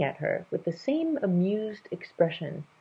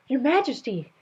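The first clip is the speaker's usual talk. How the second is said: out of breath; 9 semitones higher, 5 dB louder than usual. Acted. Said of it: "an out-of-breath voice said".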